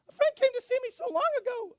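high, loud cartoon-mouse squeak; tiny mouse voice, turned up loud; Minnie Mouse-like. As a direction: imitate an over-happy woman squeaking away. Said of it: "It's almost like Minnie Mouse".

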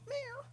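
small high-pitched meow; soft, high cat mew, imitated. Cat answering mom's question, high imitated meow. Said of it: "And Max would go".

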